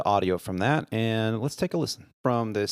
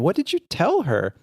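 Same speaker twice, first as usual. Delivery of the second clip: high-pitched baffled question; mock dumbfounded; 8 semitones higher than usual. Crew asks the captain for his secret, mock dumbfounded. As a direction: imitate someone amazed and needing the secret. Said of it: "just dumbfounded".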